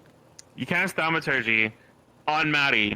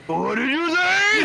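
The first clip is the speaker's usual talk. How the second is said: high, slow mocking echo; 4 dB louder, slower than usual. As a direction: stretch it out, high and warped. Echoing the quoted line.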